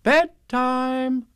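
high, sing-out mother's call; high announcing call; motherly Kanga. A narrator's reading. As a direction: call the child to bed in a high voice. Kanga calling out bedtime to child.

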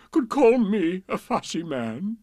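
hammed-up plaintive king; whimpering. A narrator's reading. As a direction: plead your case, sorry for yourself. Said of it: "he whimpered".

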